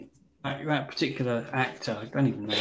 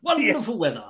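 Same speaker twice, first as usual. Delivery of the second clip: hammed-up theatrical small talk; 8 semitones higher, 3 dB louder than usual. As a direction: savour "Wonderful weather" like a stage actor. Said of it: "Just talk like that all day".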